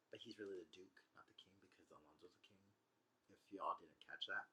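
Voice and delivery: a quick correction under the breath; muttered aside. Real, not acted. Teacher quietly corrects that Prospero is the duke.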